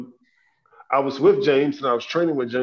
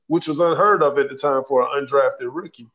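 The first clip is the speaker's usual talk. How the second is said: ordinary talk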